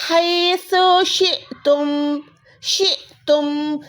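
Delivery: शिअतुम drawn out slowly; slow recitation. Shown as a demonstration. Teacher recites a second Quran example, slowly chanted. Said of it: "Quran पाक में कैसे आता है?".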